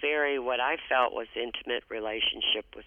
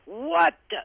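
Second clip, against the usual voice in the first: put-on much higher and louder, an outraged old mother shouting at the staff; angry yell; 12 semitones higher, 5 dB louder than usual. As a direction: yell it like an indignant mother who climbed too far. Mother yelling at hospital staff.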